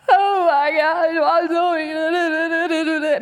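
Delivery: high, loud, drawn-out comic wail; mock crying. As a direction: wail it slowly like a hammed-up panicked sob. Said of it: "I'm a crier".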